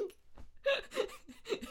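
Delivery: high-pitched comic sobs; mock sobbing. Learner overwhelmed at work, mock high sobbing. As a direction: sob helplessly, exaggerated and high. Acting out her breakdown.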